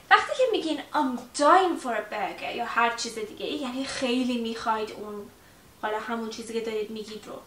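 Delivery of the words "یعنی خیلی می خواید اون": ordinary talk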